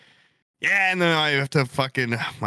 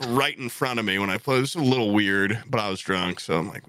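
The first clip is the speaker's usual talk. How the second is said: usual speaking voice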